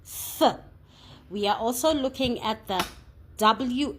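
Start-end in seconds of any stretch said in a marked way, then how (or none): 0.0-0.6 "Th." a short voiceless th, blown through the teeth; th sound demo. Shown as a demonstration. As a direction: show the hard "Th." sound alone. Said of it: "T H blend when it makes a hard sound".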